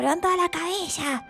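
a mock shout from the crowd; friend yelling. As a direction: call out to the stage, half in jest. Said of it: "me gritaba".

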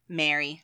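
MARY as "mair-ee"; the name Mary. Shown as a demonstration. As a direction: say MARY with the vowel of "air". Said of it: "M A R Y".

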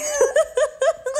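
high, loud shrieking laugh; real shrieking laugh. Real, not acted. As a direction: burst out laughing.

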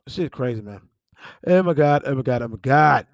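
ordinary talk

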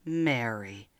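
low, soft and tender; gentle call. Acted. Jesus calling Mary by name, low tender voice. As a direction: say her name softly, lovingly. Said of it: "that person ... said".

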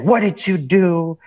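slow, pointed alarmed question in a put-on dad voice; imitating dad freaking out on the phone. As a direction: play the panicked father, mildly. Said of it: "my dad was freaking out".

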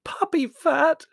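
outraged "Puppy fat" in a very high, loud squeak; loud, high-pitched indignant squeal. Acted. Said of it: "squeaked Margot".

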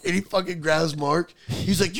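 ordinary talk

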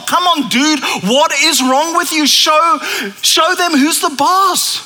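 high, exasperated buddy voice; pleading Peter. Retold or imitated. Peter pulls Jesus aside, high and exasperated pleading. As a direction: urge him to seize power, half begging. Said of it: "he's like".

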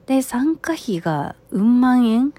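normal talking voice

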